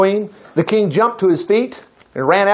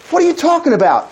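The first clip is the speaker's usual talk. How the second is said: high, indignant demand; the king demands an answer; a touch of royal outrage; 10 semitones higher than usual. Acted. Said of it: "King A demanded".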